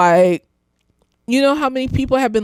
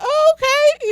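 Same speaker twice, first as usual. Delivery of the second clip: high agreeable okay; slower than usual. Retold or imitated. Agreeing with the partner, a high sweet "oh, okay". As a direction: pitch way up, light and compliant. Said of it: "I'll be like".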